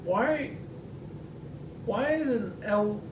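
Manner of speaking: ordinary delivery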